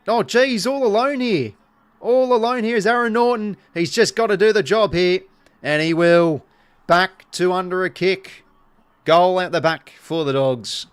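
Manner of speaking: loud, high-pitched live commentary; excited goal call. Real, not acted. Commentator calling a goal for the Dogs, excited.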